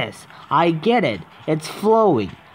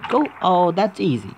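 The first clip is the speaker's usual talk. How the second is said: ordinary talk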